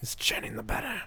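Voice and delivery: whispery movie-quote impression; rasping whisper of a film star; Christopher Walken. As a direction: whisper it like the famous speech. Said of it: "everybody always quotes is like".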